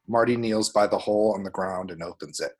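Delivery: ordinary talk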